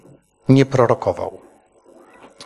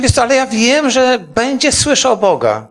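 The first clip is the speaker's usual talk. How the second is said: confident reassurance; 8 semitones higher than usual. Retold or imitated. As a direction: promise it with certainty. Father reassures son firmly. (ojciec zapewnia syna, z przekonaniem)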